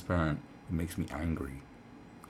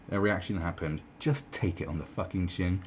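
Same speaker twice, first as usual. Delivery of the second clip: usual speaking voice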